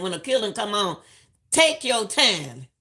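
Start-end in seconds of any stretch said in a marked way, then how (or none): 1.5-2.7 slow, drawn-out advice. Shown as a demonstration. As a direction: take your time said very slowly.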